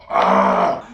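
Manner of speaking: loud, very low drawn-out groan; frustrated growl. Acted. Fed up with stolen goods resold cheap, frustrated growl. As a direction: growl out your exasperation. Exasperated over cheap resale.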